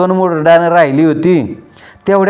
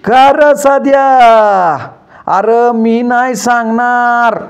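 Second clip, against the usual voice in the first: higher, drawn-out shout back across the field; 6 semitones higher, slower than usual. A narrator's reading. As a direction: yell the reply slowly over the distance. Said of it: "मी तिथनंच ओरडलो".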